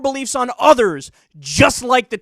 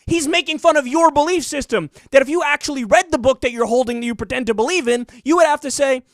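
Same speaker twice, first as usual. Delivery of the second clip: louder, higher heated explaining; angry rant; 3 semitones higher, 4 dB louder than usual. Real, not acted. Defending the tweeter to critics, loud heated rant. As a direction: keep pushing hard. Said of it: "I don't know why I'm getting so angry".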